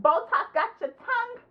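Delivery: high mocking jab with a laugh; laughing falsetto taunt; high-pitched taunting. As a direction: tease in a squeaky put-on voice. Taunting Phyllis in put-on voice.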